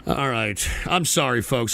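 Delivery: low, soft, deflated; drained after the outburst; weary apology. Real, not acted. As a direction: apologize with resignation. Apologizes after losing his temper.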